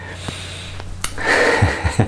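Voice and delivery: soft breathy laugh. Real, not acted. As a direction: laugh it off quietly, under the breath. Laughs after voicing his critic.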